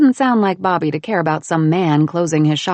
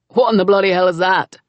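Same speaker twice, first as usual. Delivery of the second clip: louder gruff exclamation; outraged at the pig, a bit louder; exclaiming; 7 dB louder than usual. Acted. Cameron spots the pig, loud gruff disbelief.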